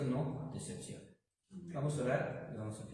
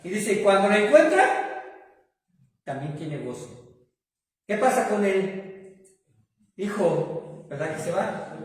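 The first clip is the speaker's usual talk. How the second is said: loud, raised preacher's voice building the point; preaching at full force; 3 semitones higher, 9 dB louder than usual. Acted. Pastor to congregation, preaching at full force. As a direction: pastor preaching loudly to his congregation about joy.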